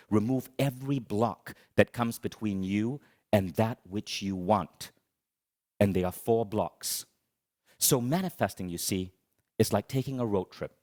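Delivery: usual speaking voice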